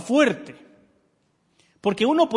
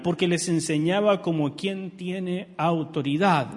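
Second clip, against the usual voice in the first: ordinary delivery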